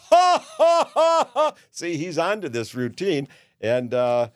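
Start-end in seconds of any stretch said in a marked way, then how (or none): 0.1-1.5 very high, loud put-on cackle; hammed-up cackle. Host cackles in his mock hotel ad. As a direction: cackle wildly at the joke.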